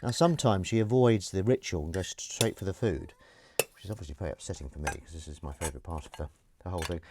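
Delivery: ordinary talk, nothing marked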